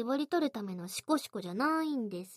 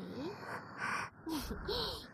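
soft teasing giggle; 5 semitones higher, 8 dB quieter than usual. Acted. Teasing laugh.

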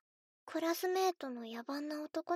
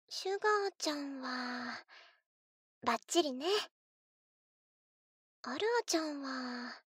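ordinary talk, nothing marked

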